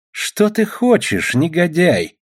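high, drawn-out indignant demand; indignant challenge. A narrator's reading. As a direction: confront a scoundrel. Rescuer angrily challenges the villain.

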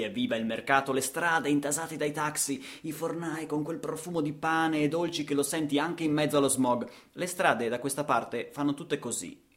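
ordinary talk, nothing marked

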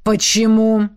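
drawn-out, demanding question; angry demand. A narrator's reading. Jealous man questioning the woman, angry demand. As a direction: press for an answer with contained anger. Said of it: "требовательно спросил он, раздувая ноздри от гнева".

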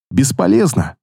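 usual speaking voice

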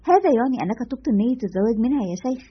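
usual speaking voice

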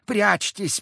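high woman's voice urging; first call to hide, high. A narrator's reading. Unseen woman echoes Zakhar, urging them to hide. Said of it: "вторила ему невидимая и неосязаемая женщина".

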